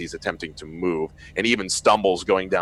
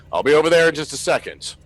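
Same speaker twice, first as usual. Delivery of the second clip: Wilson on the radio; 9 semitones higher, 9 dB louder, faster than usual. Acted. GM still voicing Wilson.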